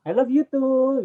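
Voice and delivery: high, loud, drawn-out love reply; playfully loving. Pretend. Playful echo of the loving demo. (balasan sayang yang main-main)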